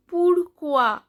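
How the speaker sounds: pourquoi said "poor-kwa"; French pronunciation demo. Shown as a demonstration. Teacher shows learners that OI is O A, demonstrated pronunciation. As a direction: sound out pourquoi with OI as "wa". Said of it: "O I, O A".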